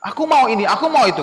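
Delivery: put-on loud, high greedy kid; demanding child. Child wanting everything at the mall, loud demands. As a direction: list your wants loudly like a child. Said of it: "Kalian juga begitu nggak?".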